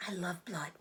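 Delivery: tiny, thin and sweet, whispered; a spider's thin, pleasant little whisper. A narrator's reading. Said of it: "her tiny, thin voice grew even thinner and more pleasant".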